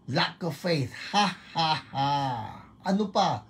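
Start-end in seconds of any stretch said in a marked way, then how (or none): 1.1-2.1 high, spaced ha's; laughing at trouble. Shown as a demonstration. Preacher laughing at lack of faith, high deliberate laugh. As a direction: declare a laugh against the problem. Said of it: "Tawanan ninyo".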